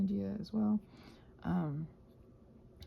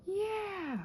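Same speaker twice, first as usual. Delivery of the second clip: high, bright little cheer; delighted yeah; 12 semitones higher than usual. Real, not acted. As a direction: cheer the result like a small win. Happy with her painting.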